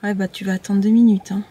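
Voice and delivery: ordinary delivery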